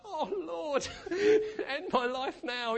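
high, very slow plea broken by laughter; laughing mock prayer for release; laughing mock despair. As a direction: beg to be rescued while laughing. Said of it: "and we were like".

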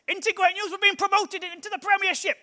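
high-pitched, excited Huddersfield fan; imitated Huddersfield bloke bursting with news; Huddersfield accent. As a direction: announce it thrilled, in a Huddersfield accent. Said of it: "this is quite a good Huddersfield accent".

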